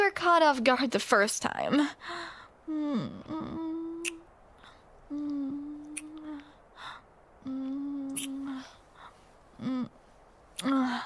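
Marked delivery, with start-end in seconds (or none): 2.7-11.0 much softer mm sounds between kisses; quiet kissing hums; soft kissing murmurs. Acted. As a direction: murmur softly while kissing.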